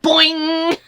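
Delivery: high loud cartoon boing; spring it out like a cartoon. Bunny ears sound effect.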